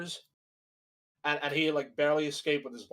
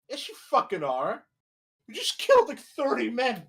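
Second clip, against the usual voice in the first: higher, louder furious outburst; outraged yelling; 7 semitones higher, 3 dB louder than usual. Real, not acted. To a killer who says he's not bad, outraged shout. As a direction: yell it at the screen in disbelief.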